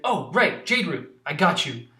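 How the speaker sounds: snappy eager helper; Nimona keen. Acted. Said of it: "She's like".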